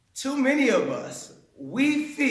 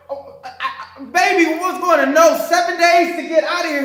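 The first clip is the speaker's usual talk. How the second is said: mock shocked husband; 7 semitones higher, 8 dB louder than usual. Husband stunned, retold story.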